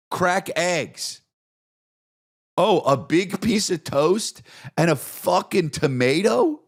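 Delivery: high-pitched, incredulous rant; hammed-up indignation at breakfast; mock outrage. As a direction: pitch it way up in comic disbelief.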